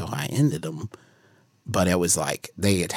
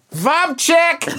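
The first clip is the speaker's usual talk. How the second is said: high, loud joke call-out; playful shout; 6 dB louder than usual. Pretend. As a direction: yell it sky-high, breaking into laughter. Calls out a joke to Joshy.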